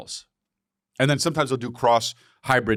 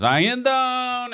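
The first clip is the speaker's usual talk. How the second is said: high, slow drawn-out I am down; meme announcer; 12 semitones higher, much slower than usual. Retold or imitated. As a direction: stretch it like a hype announcer.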